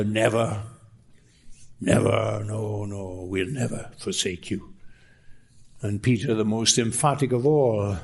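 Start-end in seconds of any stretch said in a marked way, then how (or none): 0.0-4.7 mock orator. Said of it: "The great word of the orator".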